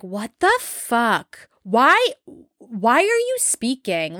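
very slow, drawn-out incredulous reaction; exasperated disbelief. Retold or imitated. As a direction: space out each word as if baffled by the nerve. Acted reaction to woman butting in.

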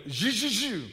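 high-pitched buzzing zaps; imitated electric zapping. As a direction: mime jump leads with your voice. Imitates jump-starting with cables.

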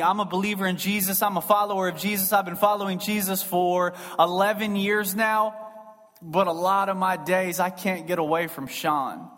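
ordinary talk, nothing marked

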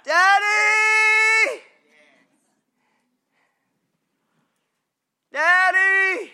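high, loud calling out to daddy; child calling dad. Retold or imitated. Preacher voicing a child calling for his daddy, high and loud. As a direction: shout it like a little boy calling across the house. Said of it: "if you just want to say it in our words".

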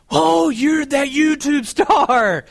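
excited fan shout. Retold or imitated. Fans recognizing him on platform.

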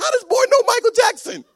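very high, loud incredulous squeal; amused disbelief. Retold or imitated. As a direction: squeak it out through amazement. Dad amazed at son's joke.

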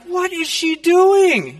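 high, drawn-out mock dismay; exasperated disbelief. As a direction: cry it out in retold alarm, slowly. Retold alarm at aunt's driving.

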